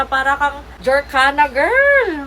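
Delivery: gleeful rising squeal on the last word; playful hype. Real, not acted. Playful hype of the pink effect.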